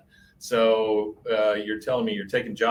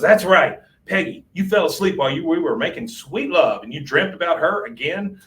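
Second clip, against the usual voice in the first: higher, a touch louder scolding; hammed-up wife telling him off; Peggy scolding Hank for the dream, mildly angry; mock angry scolding; 6 semitones higher, 4 dB louder than usual. Naked, pissed Peggy scolds Hank.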